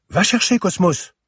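put-on high, loud child's call to a dog; excited little girl's shout; child's command. A narrator's reading. Girl throws a stick for her dog, calling out.

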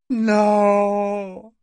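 high, loud cry of defiance and helplessness; defiant cry. A narrator's reading. Parangon cries again, defiant and helpless. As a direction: cry out refusal, defiance, powerlessness. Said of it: "cria-t-il encore en exprimant ... son impuissance".

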